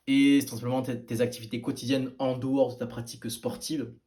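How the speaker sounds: ordinary talk, nothing marked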